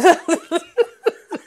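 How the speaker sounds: quick high giggle; bright laugh. Real, not acted. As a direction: laugh at yourself. Laughs at own accent switch.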